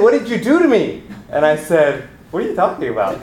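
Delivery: normal talking voice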